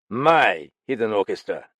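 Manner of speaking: loud curt snap on My hidden orchestra; indignant snap; snapped. Acted. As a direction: spit back My hidden orchestra in disbelief. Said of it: "Galland snapped".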